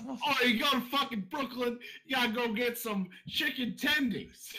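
put-on high, failed Brooklyn accent; hammed-up New York tough guy, badly done. Trying a Brooklyn accent after being mocked, badly done. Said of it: "doesn't even sound like a Brooklyn accent".

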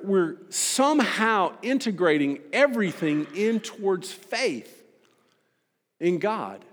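rally the congregation toward the kingdom; preaching. Acted. Preaching at full force.

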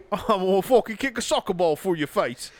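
put-on Cockney accent. Cockney arguing over soccer or football, hammed-up accent. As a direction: play a mouthy Cockney.

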